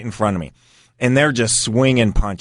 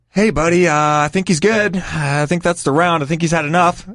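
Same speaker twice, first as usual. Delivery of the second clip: tentative placating; 6 semitones higher than usual. Retold or imitated. Acts out cautiously calming an enraged fighter.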